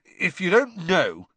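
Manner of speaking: cutting, indignant scorn; scathing. A narrator's reading. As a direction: deliver it with scathing contempt. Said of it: "said Ron scathingly".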